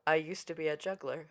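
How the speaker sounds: low, soft understated delivery; offhand reply. Retold or imitated. Actor explaining his trick to stunned crew, offhand. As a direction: say it quietly, as if it explains everything.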